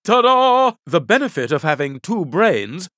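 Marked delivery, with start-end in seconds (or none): 0.0-0.7 grand entrance. Acted. Hero emerging in the nick of time.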